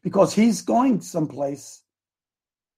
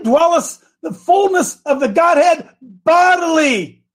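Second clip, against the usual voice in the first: slow, much higher and louder preacher's cry; fiery preaching; 9 semitones higher, 4 dB louder, much slower than usual. Acted. Preacher warning congregation, shouting scripture. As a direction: thunder the scripture out slowly.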